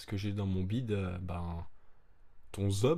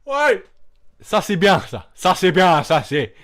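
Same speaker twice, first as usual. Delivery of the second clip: excited cheer; 10 semitones higher, 16 dB louder than usual. Retold or imitated. Fish applauding, acted cheer.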